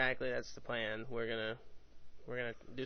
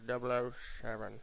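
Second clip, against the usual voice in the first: normal talking voice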